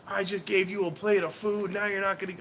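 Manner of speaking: high-pitched retold rant, no real shouting; mock screaming. Drunk neighbor berating him over a plate of food, mock outrage. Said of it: "the guy starts screaming at me".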